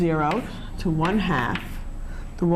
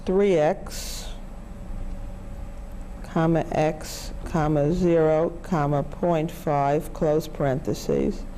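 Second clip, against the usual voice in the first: slow keystroke-by-keystroke readout; dictating; slower than usual. Shown as a demonstration. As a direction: spell out each calculator entry. Reading calculator input aloud.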